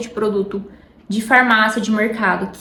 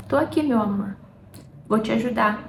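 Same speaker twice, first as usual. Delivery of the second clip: softer, gentle promise of support; tender reassurance; 6 dB quieter, faster than usual. Retold or imitated. Husband comforts his wife about starting over, tender and soft. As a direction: say it like a loving husband holding her.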